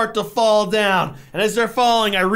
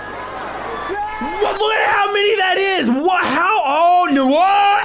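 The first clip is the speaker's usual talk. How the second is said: astonished shouting; 8 semitones higher, slower than usual. Real, not acted. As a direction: blurt it out in total shock. Reacting to domino video.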